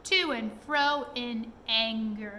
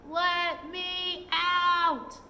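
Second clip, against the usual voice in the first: slow, drawn-out shout, higher and louder; furious shout; 6 semitones higher, 5 dB louder, much slower than usual. A narrator's reading. Trapped pixie hammering on the door, slow furious shout. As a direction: bellow it from behind a bolted door, slowly. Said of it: "was shouting".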